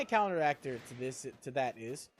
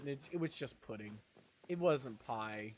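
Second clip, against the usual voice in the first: sad; 7 semitones lower, 8 dB quieter than usual. Real, not acted. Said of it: "I was sad".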